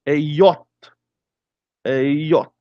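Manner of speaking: J said as "yot", German style; name J the German way, "yot"; German letter J. Shown as a demonstration. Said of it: "Olha o J".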